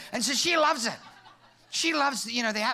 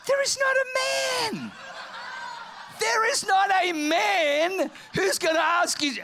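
incredulous outburst; 9 semitones higher than usual. Real, not acted. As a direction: cry out in outraged protest, stretching the words. Comic outrage at the ad.

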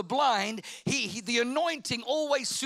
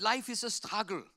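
put-on German accent; 3 dB quieter than usual. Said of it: "very thick German accent".

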